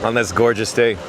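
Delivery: ordinary talk, nothing marked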